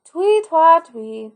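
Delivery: whistle it out while splashing; sing-song whistle; whistled tune. Acted.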